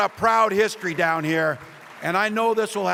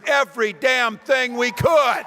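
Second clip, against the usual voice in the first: shout; 7 semitones higher, 5 dB louder than usual. Acted. Rally answer to crowd.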